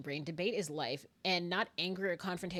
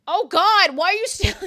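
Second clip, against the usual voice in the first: high, very loud wail; hammed-up exasperated shout; mock exasperation; 11 semitones higher, 14 dB louder than usual. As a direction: cry out in playful despair. Exasperated outburst breaking into laughter.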